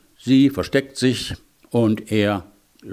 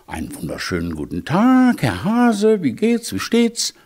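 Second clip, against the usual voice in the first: polite hedgehog; 8 semitones higher than usual. Acted. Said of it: "der Igel als höflicher Igel sagte".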